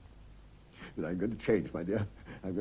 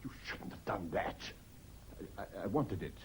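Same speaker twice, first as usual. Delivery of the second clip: whispered, much softer, stammering; shaken whisper; 5 semitones lower, 11 dB quieter than usual. Acted. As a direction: hide the panic in a whisper. Husband learns port was thrown away.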